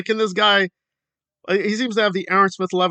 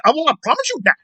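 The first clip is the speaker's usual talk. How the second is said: mock promise; 8 semitones higher, 3 dB louder, faster than usual. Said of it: "he just".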